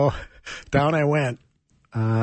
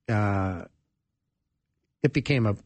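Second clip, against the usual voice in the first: ordinary delivery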